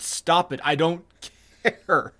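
ordinary talk, nothing marked

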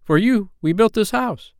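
put-on high, proud boyish announcement; lightly voiced excited boy; eager. A narrator's reading. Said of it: "he said rapidly".

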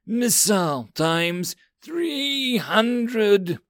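put-on high, very slow girlish casting shout; spell incantation. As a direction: call out the spell grandly. Girl mage casts her spell.

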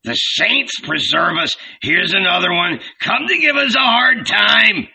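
exasperated complaint, climbing in pitch at the end; put-upon old grouch; grumbling. A narrator's reading. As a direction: grumble at the newcomer. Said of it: "grumbled Zebedee".

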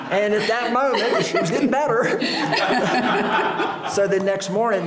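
words squeezed high and loud through laughter; laughing hard. Real, not acted. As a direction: talk while still cracking up, loud and high. Still laughing, telling the story.

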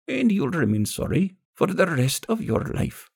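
put-on high, gravelly old woman pronouncing a curse; vengeful old crone, high-pitched. A narrator's reading. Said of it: "The old woman's gravelly voice".

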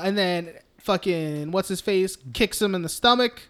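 ordinary talk, nothing marked

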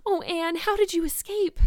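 put-on a girl near tears with relief and joy; high, loud tearful relief; weeping with relief. A narrator's reading. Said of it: "weeping with relief and delight".

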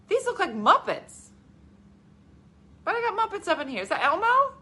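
high, baffled outrage; mock disbelief. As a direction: question it in high-pitched comic dismay. Recalls shock at seeing Muppets.

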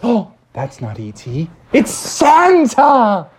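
slow, high drawn-out "Santa"; surprised realisation. Acted. The crowd spotting Santa, slow amazed shout. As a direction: gasp out the name, drawn out.

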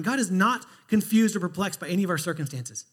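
ordinary delivery

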